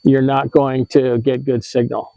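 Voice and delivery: normal talking voice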